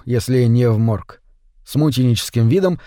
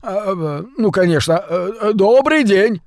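flustered; 6 semitones higher than usual. A narrator's reading. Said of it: "растерялся от неожиданности и испуга".

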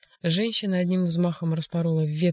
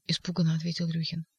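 ordinary delivery

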